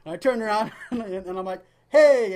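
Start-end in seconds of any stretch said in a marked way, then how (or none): 1.9-2.3 high, loud retold "hey"; loud greeting. Greeting a fan on the sidewalk, loud greeting. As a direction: call out a big hello.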